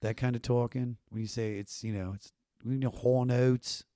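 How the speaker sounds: lightly voiced Baltimore accent with rounded O's; a hint of a Baltimore twang. Shown as a demonstration. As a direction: roll the O's like a Baltimore local. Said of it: "roll my O's and be like".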